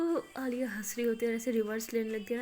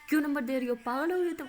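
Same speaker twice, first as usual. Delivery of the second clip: sharp, louder angry scold; 4 semitones higher, 6 dB louder than usual. Acted. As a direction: snap at her friend in disbelief. Maya scolds friend giving number. (ज़ोर से गुस्से में डांटना)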